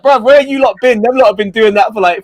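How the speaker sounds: retold high, loud protest; acted indignant comeback; incredulous retort. Retells his incredulous reply to newcomers.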